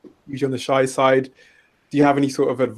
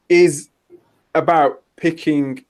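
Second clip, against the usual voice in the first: ordinary talk, nothing marked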